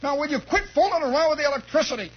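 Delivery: high-pitched exasperated mom; mock scolding, pitched high; scolding mother. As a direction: play a mother lecturing her foolish kids. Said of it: "my mother's got her arms around".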